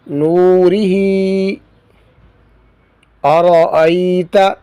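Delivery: slow, spaced word-by-word recitation. Shown as a demonstration. Teacher reading drilled words fluently for students, measured recitation. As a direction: read each word cleanly with pauses.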